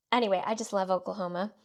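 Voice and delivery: usual speaking voice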